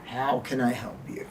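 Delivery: low, soft, flat-toned boredom. Shown as a demonstration. Bored clerk answering a customer, low and soft. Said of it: "And I say".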